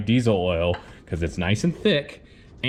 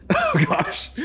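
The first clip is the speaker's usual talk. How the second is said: surprised. Real, not acted. Smelling gasoline in oil.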